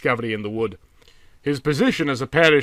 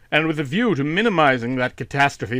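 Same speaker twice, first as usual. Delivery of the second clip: ordinary delivery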